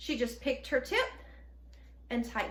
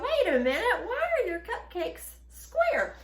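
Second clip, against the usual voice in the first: higher, slowing mock-puzzled question; puzzled onlooker; 8 semitones higher than usual. Guest questioning the square cupcakes, mock puzzlement. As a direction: play a baffled guest, pitched up. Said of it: "It makes people go".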